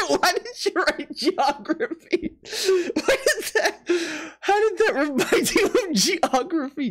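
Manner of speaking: high, slow words squeezed through laughing; helpless laughter. Real, not acted. Streamer baffled by geography guess, cracking up. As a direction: laugh hard while demanding an explanation.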